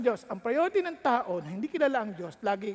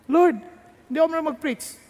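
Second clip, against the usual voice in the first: fast, higher pleading; anxious plea; 5 semitones higher, 8 dB louder, faster than usual. Acted. Young pastor quickly pleading excuses to God. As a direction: rush the excuse out.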